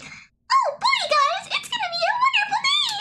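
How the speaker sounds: very high, fast squeaky cartoon voice; Minnie Mouse voice. Mocking how a friend voices her, very high and fast. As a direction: chirp it out like Minnie Mouse, rushing. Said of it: "sound like a Minnie Mouse".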